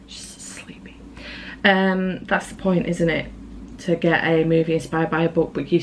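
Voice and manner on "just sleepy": breathy whisper, barely voiced. Real, not acted. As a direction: drop to a hushed whisper. Quiet aside while filming.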